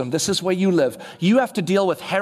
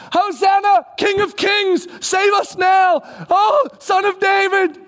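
high, loud, slow shouted praise; loud drawn-out Hosanna shout; crowd shouting; 11 semitones higher, 5 dB louder, slower than usual. Retold or imitated. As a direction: cry out like a jubilant crowd.

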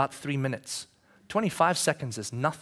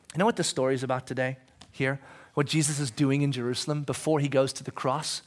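ordinary delivery

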